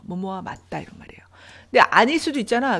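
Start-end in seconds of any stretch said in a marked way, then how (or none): 0.0-1.2 whispered gloss. Real, not acted.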